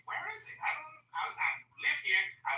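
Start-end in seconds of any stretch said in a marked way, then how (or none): 0.1-0.6 a high, quieter puzzled query; puzzled mock question. African watching TV images asks where that is, high and puzzled. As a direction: toss it up like you're baffled. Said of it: "I'm like".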